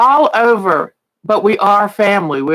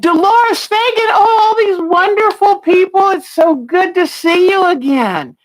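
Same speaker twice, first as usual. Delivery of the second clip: delighted greeting; 10 semitones higher than usual. Real, not acted. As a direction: sing out their names with joy. Greeting returning viewers.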